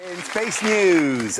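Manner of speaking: slow, drawn-out show announcement; savoured, elongated segment intro; relishing it. Acted. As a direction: stretch out each word with relish. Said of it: "I love saying this".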